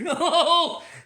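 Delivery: high, loud burst of laughter. Real, not acted. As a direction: crack up loudly. Laughing at the butcher.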